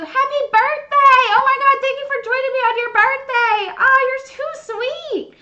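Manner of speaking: high, loud, gushing birthday wishes; excited birthday gush. Real, not acted. Streamer greeting friend Andrea on her birthday, gushing.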